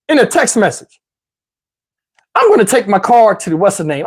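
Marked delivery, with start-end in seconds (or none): none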